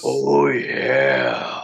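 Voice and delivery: deep, strained attempt at the wrestler catchphrase; failed Savage oh yeah. Co-host says it comes off wrong, a deep strained oh yeah. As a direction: go low and strain the oh yeah, missing the mark. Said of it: "instead of a Macho Man thing".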